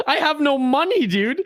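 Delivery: laughing through the words; giggling admission of no money. Real, not acted. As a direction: laugh it off, broke and amused.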